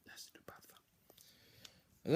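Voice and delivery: whispered slowly, drawn out. Real, not acted. Whispering his own odd phrase back to himself. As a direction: breathe it out slowly as a joke.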